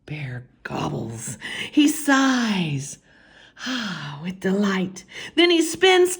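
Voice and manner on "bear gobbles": plain reading voice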